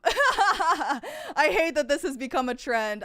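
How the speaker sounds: slow, laughing complaint. Real, not acted. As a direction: laugh through the grumble. Laughs at chat's trend.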